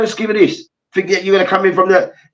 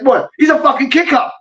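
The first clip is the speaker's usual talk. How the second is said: mock indignant teammate; 7 semitones higher than usual. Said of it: "Then the man was like".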